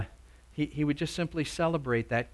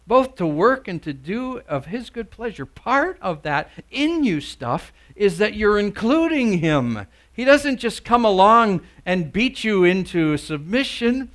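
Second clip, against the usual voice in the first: raised, louder pulpit voice with swooping emphasis; forceful preaching; 8 semitones higher, 11 dB louder than usual. Real, not acted. Preacher explaining God working in you, forceful preaching. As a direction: drive the point home to the congregation.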